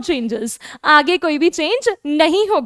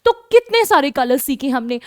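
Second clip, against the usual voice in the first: ordinary talk, nothing marked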